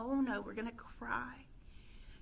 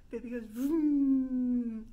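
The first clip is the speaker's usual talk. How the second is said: revving vroom; 10 dB louder, much slower than usual. Shown as a demonstration. Vroom noise.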